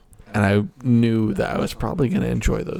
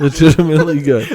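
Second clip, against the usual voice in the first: 1 laughing; 4 semitones higher, 6 dB louder, faster than usual. Real, not acted. Repeats praise laughing, fast.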